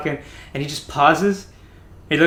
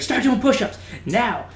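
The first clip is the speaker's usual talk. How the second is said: high, loud imitated command; retold drill yell; 11 semitones higher, 4 dB louder than usual. Instructor to crying recruit, retold yelled order. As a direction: bark it like an instructor punishing someone.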